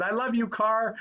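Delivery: a light mock of a man overjoyed; joyful. George Bailey to his crashed car, delighted. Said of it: "he said".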